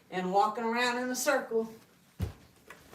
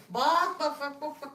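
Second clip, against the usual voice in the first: chicken clucking; 5 semitones higher than usual. Pretend. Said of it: "do the chicken dance".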